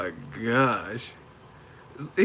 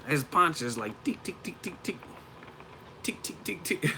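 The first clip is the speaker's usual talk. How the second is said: mock Kazakh accent with tiny high ticks; Golovkin; 6 semitones higher, 6 dB quieter than usual.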